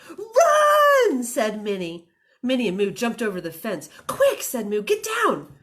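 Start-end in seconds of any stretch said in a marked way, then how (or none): 0.1-1.2 high, loud, drawn-out yell; alarmed storybook cry; urgent shout. A narrator's reading. Character sees farmer coming. 4.1-4.4 high, loud burst; urgent order. A narrator's reading. Character hurrying friend to hide. 4.8-5.5 high, loud command; urgent order. A narrator's reading. Character hurrying friend to hide.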